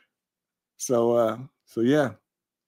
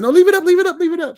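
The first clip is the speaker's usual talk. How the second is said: high, bright mock woman's voice, much louder; quoting a friend; 7 dB louder, much faster than usual. Host imitating Calamity Jane urging him to keep the video up, in a high, louder voice. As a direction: pitch way up to imitate her insisting. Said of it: "Calamity Jane said".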